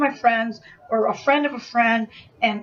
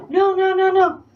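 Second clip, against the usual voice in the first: high, louder imitation of pleading off; rapid string of raised mock protests; alarmed protest; 7 semitones higher, 4 dB louder than usual. Friend facing the attacker, alarmed protest.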